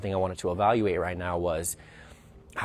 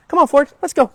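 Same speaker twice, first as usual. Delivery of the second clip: loud, high "come on, Ford, let's go"; trainer's bright go command; firm upbeat command; 12 dB louder, faster than usual. Real, not acted. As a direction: call the dog forward with energy. Commanding the dog.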